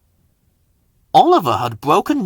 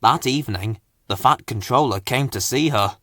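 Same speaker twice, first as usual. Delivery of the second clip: ordinary delivery, nothing marked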